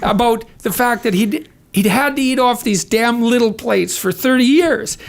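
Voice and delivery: chuckling through the story, voice lifted; laughing while telling. Real, not acted. Telling the host a funny family story, laughing. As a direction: let the laugh leak into the words.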